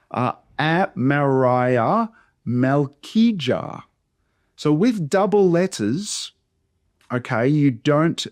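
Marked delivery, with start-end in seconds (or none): 0.6-3.8 "Amariah" and "Malkijah" drawn out syllable by syllable; half speed, every syllable of "Amariah" and "Malkijah" distinct; slow, by syllable. Shown as a demonstration. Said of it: "I'm gonna pronounce them slowly".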